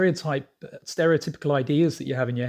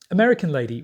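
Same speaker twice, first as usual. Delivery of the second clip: normal talking voice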